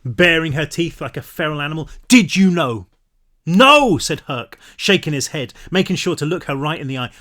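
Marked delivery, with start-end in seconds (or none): 2.1-2.8 louder, pressing repeat with stress on Did; angry repeat. A narrator's reading. Pan presses Herc again, insistent anger. 3.4-4.0 loud, high emphatic no; firm denial. A narrator's reading. Herc denies knowing to Pan, emphatic no.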